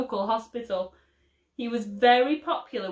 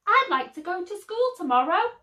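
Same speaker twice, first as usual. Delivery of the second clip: put-on high, quick little baby voice; 10 semitones higher, 4 dB louder, faster than usual. A narrator's reading. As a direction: pipe it up brightly like a tiny child. Baby character speaks first words.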